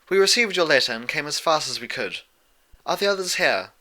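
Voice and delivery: a lightly voiced gracious princess, rising a little; gentle princess. A narrator's reading. Princess greeting her student on arrival, gently regal.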